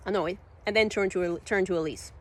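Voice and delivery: usual speaking voice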